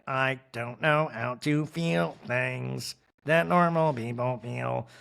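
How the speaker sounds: slow, stilted robot delivery; robotic. Retold or imitated. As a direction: say it like a robot trying to sound human. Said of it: "it's still so robotic".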